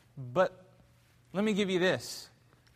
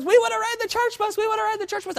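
put-on much higher, excited child voice; eager little girls; 6 dB louder, faster than usual. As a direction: squeal it like a girl begging for the bus.

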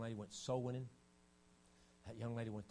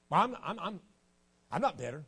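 mock denial; 8 semitones higher, 12 dB louder than usual. Preacher mimics a bitter churchgoer.

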